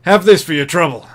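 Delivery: loud, brash promoter voice; gruff fight promoter. Acted. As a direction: talk like a seedy fight promoter. GM voices ring announcer.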